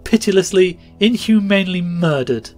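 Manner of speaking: high, loud bitter accusation; passionate. A narrator's reading. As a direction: spit out the horror. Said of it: "said the woman passionately".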